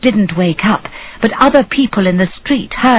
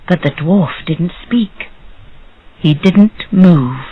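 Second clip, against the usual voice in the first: plain reading voice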